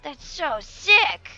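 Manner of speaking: loud excitement. Real, not acted. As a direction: stretch it out and yell in awe. Repeats the reaction, far bigger.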